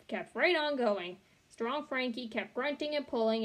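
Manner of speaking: ordinary delivery, nothing marked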